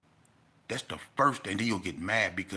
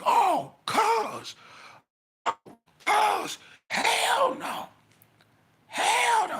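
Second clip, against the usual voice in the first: high, loud panicked yelps; frantic yelling; 7 dB louder, much slower than usual. Retold or imitated. Inmate bursting out of the cell, yelling in high panic. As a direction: screech it like a man who just saw something awful.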